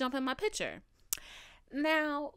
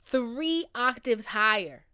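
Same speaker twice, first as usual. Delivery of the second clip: loud and drawn out for comic emphasis; slow it down and belt it out; exaggerated emphasis; 2 semitones higher, 9 dB louder, slower than usual. Shown as a demonstration. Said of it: "my pitch is, like, three octaves higher".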